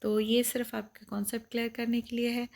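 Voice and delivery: ordinary delivery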